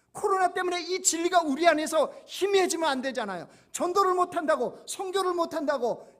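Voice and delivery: high, loud impassioned sermon delivery; preaching at full force. Acted. Pastor rallying the congregation during Covid, loud impassioned preaching. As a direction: proclaim it to the back pews.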